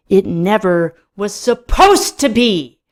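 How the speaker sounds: slow, loud, rising protest; bellow. A narrator's reading. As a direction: roar it out in frustration. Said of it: "I bellow".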